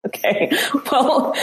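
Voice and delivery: usual speaking voice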